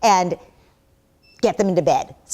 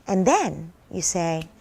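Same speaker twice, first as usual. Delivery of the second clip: usual speaking voice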